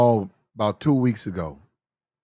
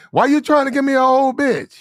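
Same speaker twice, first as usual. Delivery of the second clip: incredulous outburst; 11 semitones higher, 5 dB louder than usual. Retold or imitated. Retold outburst at the girl.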